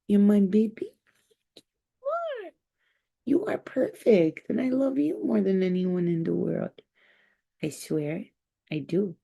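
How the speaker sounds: high, adoring coo. Real, not acted. Owner telling her dog it's her baby, high cooing.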